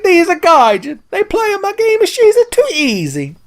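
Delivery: very high, hammed-up Italian accent; Miyamoto. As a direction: rant like an angry cartoon Italian. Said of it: "Like how he became Italian".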